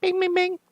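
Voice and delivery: high-pitched rapid bings; high imitated chime. Player voicing the game's Bat computer alert, high chime. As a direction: squeak out a little alert beep.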